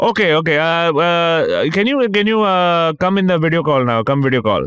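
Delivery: put-on high, halting Indian-accented scammer. Scammer urges his target onto video, imitated Indian accent. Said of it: "it's a dude with a Indian accent".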